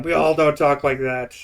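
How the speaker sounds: retold outburst, forceful but playful; mock outraged protest. Imitating a lady going ballistic on him, mock outrage. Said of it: "goes ballistic on him".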